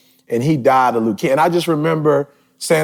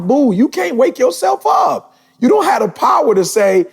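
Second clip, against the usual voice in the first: mocking shout; 9 semitones higher than usual. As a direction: laugh them down from above. Mocking cocky rich people.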